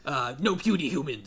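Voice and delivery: grumbling green monster; Hulk voice. Pretend. Imitating the Hulk.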